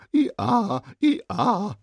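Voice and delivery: high braying hee-haw; donkey bray. Acted. Boy recalling his donkey role with a bray. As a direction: bray like a school-play donkey. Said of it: "den Esel gespielt".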